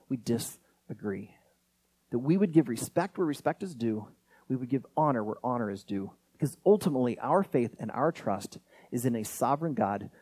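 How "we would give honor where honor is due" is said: ordinary talk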